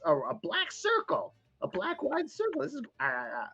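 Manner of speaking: very high, fast squeaky creature chatter; gleeful tiny creature digging through a bag; excited little goblin. Acted. Creature describing found object.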